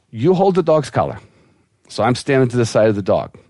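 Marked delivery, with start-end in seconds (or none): 0.1-1.2 higher-pitched Russian woman; Russian accent. Acted. Russian vet instructing the dog's owner, higher Russian accent. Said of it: "vet with a Russian accent".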